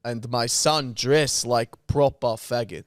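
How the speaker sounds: boastful mock-Russian, slowing down; Russian accent. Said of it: "how do you do Russian accent".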